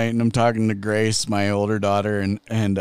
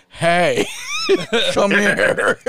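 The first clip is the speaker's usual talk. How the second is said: mock call out; 10 semitones higher, 4 dB louder, much slower than usual. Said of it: "and Trinity goes".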